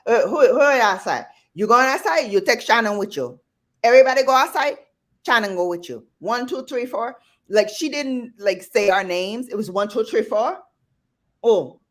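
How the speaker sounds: put-on high, loud Caribbean grandma rounding up kids; Caribbean accent, commanding and high; Caribbean grandmother accent. Shown as a demonstration. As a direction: perform the Caribbean grandma bossing everyone outside. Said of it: "hit your father's mother accent".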